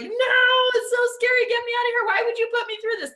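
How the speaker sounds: put-on high, loud, frantic kid begging to escape; panicking child. Kid melting down in front of the scratching box, frantic. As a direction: wail like a terrified four-year-old.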